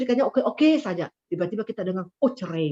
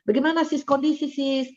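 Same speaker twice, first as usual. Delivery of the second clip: friendly question; 7 semitones higher than usual. Retold or imitated. Asking a friend how she is.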